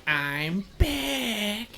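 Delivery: lightly voiced cartoon kid; a hint of a South Park kid; Kyle impression. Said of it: "I'm like other Kyle from South Park".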